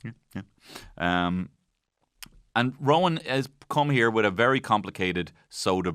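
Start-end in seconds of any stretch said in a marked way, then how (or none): 0.0-1.3 much softer bored hum; barely interested agreement; bored murmur. Retold or imitated. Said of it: "the partner's like".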